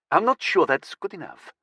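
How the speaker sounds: put-on light French accent. A narrator's reading. Girlfriend doubts the drivers' waiver is enough, light French accent. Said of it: "in a light French accent".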